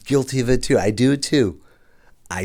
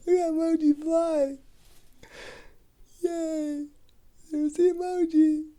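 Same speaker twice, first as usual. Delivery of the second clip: excited squeal; 4 dB louder, slower than usual. Real, not acted. Excited about live emoji.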